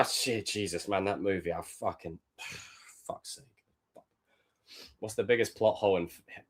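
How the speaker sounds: usual speaking voice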